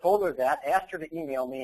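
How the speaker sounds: ordinary talk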